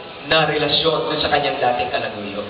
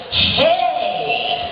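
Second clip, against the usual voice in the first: high teasing former lover; much slower than usual. Acted. Former lover overtakes him and shouts high to be noticed. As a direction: jump in front of him and squeal for attention.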